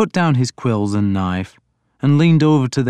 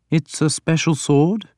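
higher-pitched, lightly voiced questioning lord; 6 semitones higher than usual. A narrator's reading. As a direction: ask it lightly, pitch lifted to a rise. Alfred questions the young narrator.

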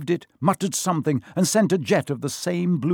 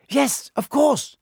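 very high, agitated voice; flustered realisation; 13 semitones higher, 4 dB louder, slower than usual. A narrator's reading. Hermione grasping Harry's suggestion, flustered. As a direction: agree in a frantic fluster.

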